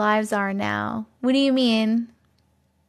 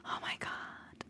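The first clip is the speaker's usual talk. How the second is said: shocked whisper; 23 dB quieter, slower than usual. Real, not acted. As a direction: gasp then whispered disbelief. Shocked chatter hasn't seen movie.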